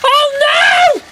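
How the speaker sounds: high, loud oh no; real thrill at the crash; excited shout. Real, not acted. Watching Thomas derail, excited shout.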